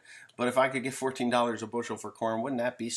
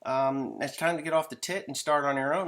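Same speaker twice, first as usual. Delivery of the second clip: higher, lecturing parent voice; mock stern parents; 6 semitones higher than usual. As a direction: scold the grown kid like a fed-up parent. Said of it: "mom and dad say".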